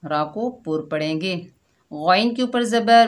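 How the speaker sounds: ordinary talk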